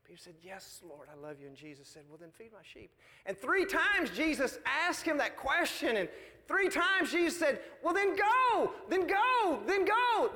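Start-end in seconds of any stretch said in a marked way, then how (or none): 0.0-2.9 very soft, hushed and low; tender. Acted. Jesus and Peter after the denial, tender and hushed. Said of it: "tender experience". 7.8-10.3 high, rising-and-falling exhortation; urgent commissioning. Acted. Jesus sending Peter back to his mission, urging him on.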